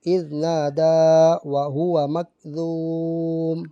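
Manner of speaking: slow, drawn-out tajweed chant; Quran recitation. Shown as a demonstration. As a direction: chant each word slowly with long vowels. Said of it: "پھر آگے پڑھیں گے".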